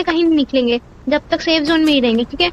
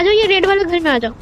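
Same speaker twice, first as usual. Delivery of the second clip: ordinary delivery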